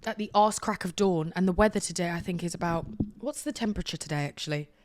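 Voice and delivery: usual speaking voice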